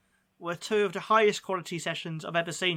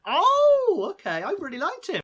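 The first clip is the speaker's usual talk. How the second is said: much higher, louder startled oh; surprised; 13 semitones higher, 7 dB louder than usual. Real, not acted. Co-host disagrees about the pundit, startled high oh. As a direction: caught off guard by disagreement.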